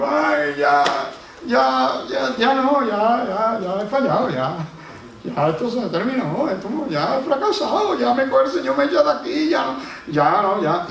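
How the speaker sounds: high, loud, whimpering lament of giving up; despairing whine. Pretend. A discouraged believer to God, loud high whining despair. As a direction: wail like a beaten child who quits.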